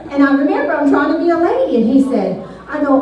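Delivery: ordinary talk, nothing marked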